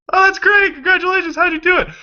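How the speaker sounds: very high, loud mock enthusiasm; fake gushing. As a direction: gush with put-on cheer.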